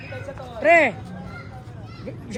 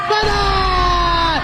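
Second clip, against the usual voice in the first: excited shout; 4 semitones higher than usual. Real, not acted. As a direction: yell it to hype the play. Cheering a play. (malakas na sigaw)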